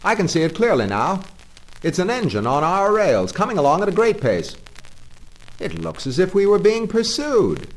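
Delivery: high, loud alarmed call. Acted. Engine driver calling back to Toad about the pursuing engine, alarmed. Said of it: "Presently he called out".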